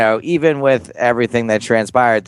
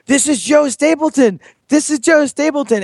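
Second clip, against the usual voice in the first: much higher, pushing her to look; mock eager announcing; excited introducing; 11 semitones higher than usual. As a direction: insist to the girlfriend, excited. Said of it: "harassed his girlfriend".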